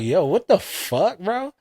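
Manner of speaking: high, incredulous what the fuck; shocked outburst. Retold or imitated. Husband reacting in disbelief to the store lady, high and shocked. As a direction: voice jumps up in disbelief.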